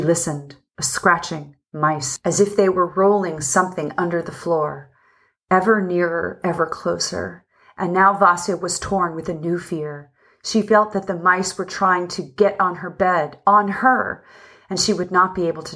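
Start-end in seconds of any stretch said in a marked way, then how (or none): none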